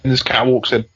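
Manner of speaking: ordinary talk, nothing marked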